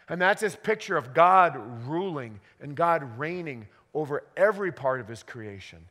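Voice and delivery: ordinary talk, nothing marked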